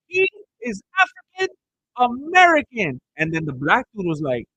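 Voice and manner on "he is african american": high-pitched, emphatic imitation of indignation; mock offended. Imitating a lady correcting someone for a coworker, offended. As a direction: correct them pointedly, voice pitched way up. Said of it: "trying to get all offended".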